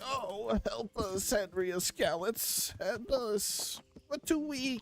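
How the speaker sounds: high, whiny fake-sick pleading; mock helpless villagers. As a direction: ham up feeble begging. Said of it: "they're like".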